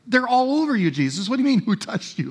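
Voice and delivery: high, fast, exasperated; as if the question were absurd; exasperated disbelief. Retold or imitated. Disciples telling Jesus the crowd is everywhere, exasperated. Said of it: "They're like".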